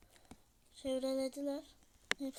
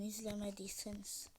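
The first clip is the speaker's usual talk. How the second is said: whispering; 4 semitones lower, 8 dB quieter than usual. Real, not acted. Aside to viewers.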